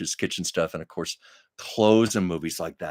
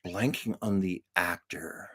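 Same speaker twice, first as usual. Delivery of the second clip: whisper; 4 semitones lower, 8 dB quieter than usual. Real, not acted. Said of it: "forgive me".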